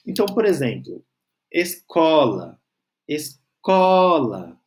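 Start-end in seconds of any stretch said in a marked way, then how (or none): 1.5-2.6 "escola" with a drawn-out, emphatic CO; slow "escola", middle syllable stressed; stressed tonic syllable. Shown as a demonstration. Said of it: "que ela soa mais forte". 3.1-4.6 "escola" with the CO stretched long and strong; very slow "escola", middle syllable swelling; exaggerated tonic syllable. Shown as a demonstration. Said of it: "que ela soa mais forte".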